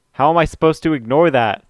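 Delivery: high, loud angry outburst; mad outburst. Acted. Sayori bursting out angrily at her friend, loud and high. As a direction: snap at him in real anger. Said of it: "I've never seen Sayori this mad before".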